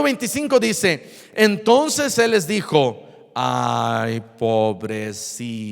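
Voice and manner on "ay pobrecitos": low, slow, drawn-out soft pity; hammed-up low commiseration; mock pity. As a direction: draw it out in false sympathy. Mock pitying answer, not the verse.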